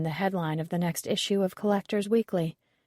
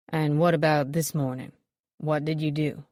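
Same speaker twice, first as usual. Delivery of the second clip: lightly voiced detective; 3 semitones lower than usual. A narrator's reading. Detective asks.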